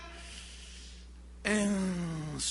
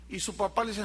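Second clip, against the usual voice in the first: ordinary talk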